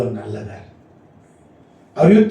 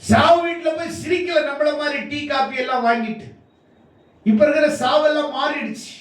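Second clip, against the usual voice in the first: raised, sustained declamation; high-pitched preaching; 11 semitones higher than usual. Real, not acted. Preacher contrasts Jesus with today's funeral visitors, high-pitched preaching. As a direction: keep the pitch up through each phrase.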